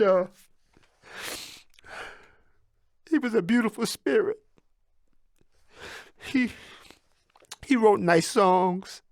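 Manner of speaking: halting, choked-up memory; voice breaking. Real, not acted. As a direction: talk through tears about a beloved father. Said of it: "I'm about to get emotional".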